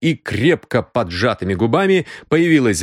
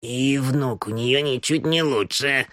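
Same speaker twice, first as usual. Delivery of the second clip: put-on plaintive old woman, softer and slower; 3 semitones higher, 5 dB quieter than usual. Housekeeper complains about the grandson, plaintive. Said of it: "жалобным голосом".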